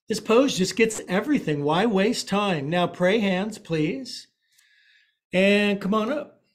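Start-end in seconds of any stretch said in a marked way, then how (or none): none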